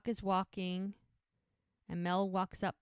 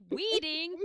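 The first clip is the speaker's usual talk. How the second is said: high, loud indignant "Weeding?"; mock outrage; 9 semitones higher, 6 dB louder than usual. Hope reading the misprinted invite, outraged and shocked. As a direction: act out Hope's shocked disbelief.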